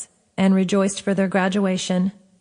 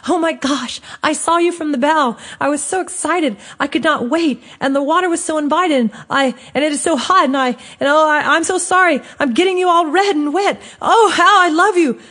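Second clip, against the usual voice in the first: high, breathless gushing, tumbling faster, getting louder; excited blubbering; 9 semitones higher, 5 dB louder than usual. Acted. Soaked narrator hugging Lion, gushing overjoyed, higher and louder. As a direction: pour it all out at once, overjoyed and flustered. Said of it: "I blubbered".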